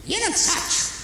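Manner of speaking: lightly mocking the mother, pitched high; squeaky put-on old-mother voice; mother brushing off the parking fine, in a high mock voice. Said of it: "mother answers".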